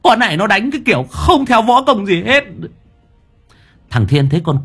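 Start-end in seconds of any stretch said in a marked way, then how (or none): 0.0-2.4 loud, very high panicked yell; high-pitched hollering, getting higher; howling for help. A narrator's reading. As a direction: shriek for rescue in comic desperation. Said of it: "Lão rú lên".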